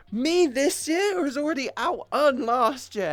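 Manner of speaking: high, loud, disbelieving outburst; ranting back at the bank over the rejection; exasperated rant. Retold or imitated. Fed-up complaint to the bank. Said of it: "I'm like".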